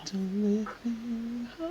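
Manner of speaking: slow, high whiny joke voice; silly high voice. Pretend. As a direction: whine it like a cartoon. Player jokingly whines.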